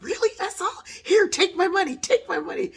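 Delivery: loud, high-pitched rush of insistence; eager excited outburst. Retold or imitated. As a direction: burst out high and loud, pushing the offer. Acts out eager offer of money.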